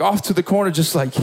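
ordinary delivery

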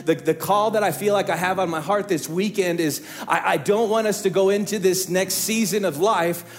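full-force preaching. Acted. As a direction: preach it hard to the whole church. Pastor preaches to congregation.

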